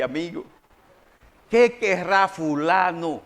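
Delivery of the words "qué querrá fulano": slow, lowered, wondering aside; wary suspicion. Acted. Host eyeing a visitor who only comes needing something, slowly suspicious. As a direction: draw out the wary question.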